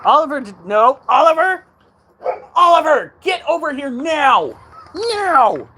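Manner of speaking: furious high shout at the dog; calling from far away. Real, not acted. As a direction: yell across the yard, losing patience.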